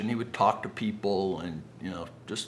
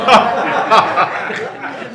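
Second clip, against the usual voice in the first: short breathy laugh; 10 semitones higher, 12 dB louder than usual. Real, not acted. Laughs about unpaid staff.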